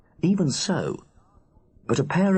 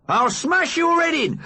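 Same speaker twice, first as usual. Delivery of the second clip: furious threat. Acted. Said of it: "Big Mac attacks".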